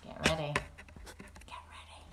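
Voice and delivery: soft, fading whisper of warning; suspense hush. Acted. Teacher warns children before the big surprise, hushed. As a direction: build tension quietly. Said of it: "I'm warning you, get ready".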